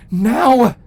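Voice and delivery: loud, urgent command; urgent shout. A narrator's reading. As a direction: bark the order, no time to lose. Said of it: "sensed the urgency in Cocoon's voice".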